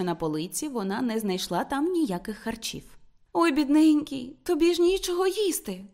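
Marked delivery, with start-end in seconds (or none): 3.3-5.8 higher, pitying coo; tender, sympathetic exclamation. A narrator's reading. Woman pitying Martin with no food, tender and sympathetic. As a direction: exclaim with fond pity. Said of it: "вигукнула Рут ніжно і співчутливо".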